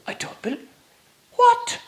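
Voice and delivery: breathy whisper rising to a startled high cry; shocked. Acted. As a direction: stammer in disbelief, then squeak. Master shocked at the news.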